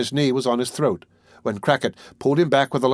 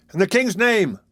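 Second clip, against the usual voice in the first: crowd calling out; 9 semitones higher than usual. A narrator's reading. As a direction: shout it from outside the door. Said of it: "cried the voices without".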